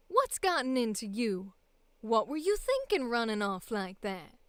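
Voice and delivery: feminized Southern audition voice; Southern accent. Acted. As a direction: play the character with a Southern twang. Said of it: "the middle Southern one".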